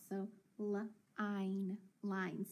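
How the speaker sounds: line sounded out, then blended; sounding out line. Shown as a demonstration. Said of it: "I'm gonna mark it out".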